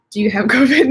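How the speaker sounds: amused laugh mid-question; laughing while reading. Real, not acted. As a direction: laugh as you read the comment out.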